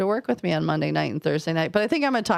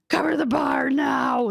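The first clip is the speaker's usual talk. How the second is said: high, urgent order to cover the bar; panicked boss giving orders; urgent command; 8 semitones higher than usual. Retold or imitated. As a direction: bark it out like an emergency.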